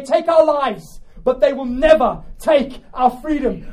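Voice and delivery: rallying speech swelling to a loud shout; building battle cry. Acted. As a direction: build to a high, loud climax. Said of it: "shouting these words".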